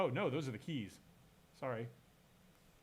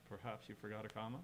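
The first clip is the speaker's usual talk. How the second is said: softer, lower, condescending British-style remark; rude British reading; 3 semitones lower, 9 dB quieter, faster than usual. Shown as a demonstration. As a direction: say it low and quiet, like a rude British put-down. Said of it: "if you say that with a British accent".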